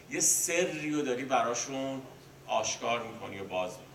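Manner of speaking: ordinary talk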